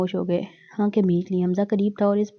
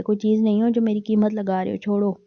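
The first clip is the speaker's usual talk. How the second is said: ordinary delivery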